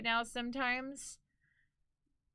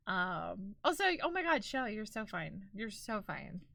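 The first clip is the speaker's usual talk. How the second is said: usual speaking voice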